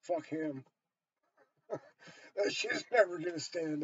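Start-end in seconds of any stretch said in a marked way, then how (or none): none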